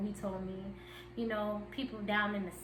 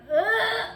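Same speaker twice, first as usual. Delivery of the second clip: mock groan; 11 semitones higher, 8 dB louder than usual. Reacting to "are you ready.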